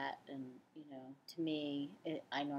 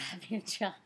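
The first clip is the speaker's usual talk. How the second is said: ordinary delivery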